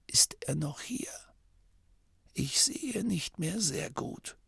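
dying abbot's whisper. A narrator's reading. The dying abbot whispering a secret into Masklin's ear. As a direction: breathe it out like a frail dying old man.

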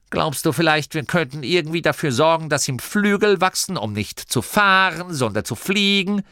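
high sarcastic questioning; Gurder, mocking elder. A narrator's reading. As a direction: pile on the ridicule. (spöttisch und hoch gestichelt)

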